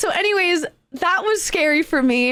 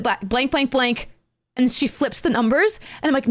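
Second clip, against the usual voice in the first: ordinary talk, nothing marked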